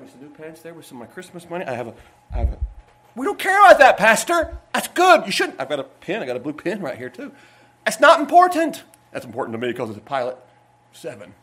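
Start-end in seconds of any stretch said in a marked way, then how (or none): 3.2-5.6 high, loud dismissive interjection; congregation's retort. Acted. Acts congregation answering the pastor. 7.8-8.8 raised, higher-pitched dismissal; congregation's retort. Acted. Acts congregation answering the pastor.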